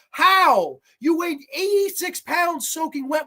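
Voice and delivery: How? shouted up high; incredulous yell; outraged shout. Real, not acted. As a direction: bark it in disbelief. Outraged rant.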